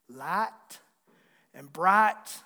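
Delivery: "Light" and "bright" with a drawn-out, flattened country I; exaggerated regional I. Shown as a demonstration. Preacher shows his home accent, "Light" and "bright" with emphasized country I. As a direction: lean hard on the I in "Light" and "bright". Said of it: "we tend to put a lot of emphasis on our eyes".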